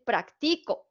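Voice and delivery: "practico" stressed on the middle syllable, prac-TI-co; stress on second syllable. Shown as a demonstration. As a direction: move the weight to TI in "practico". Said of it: "si yo se la pongo en la siguiente".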